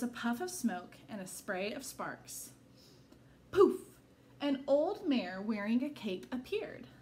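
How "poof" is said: loud, high burst; magic poof. A narrator's reading. Storyteller reading to children, a magic poof loud and high. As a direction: pop it out like a spark.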